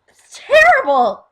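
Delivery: high, very loud wail of protest; mock outraged cry. Fan protesting that nobody would do that in public, loud high outcry. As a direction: cry out in exaggerated horror.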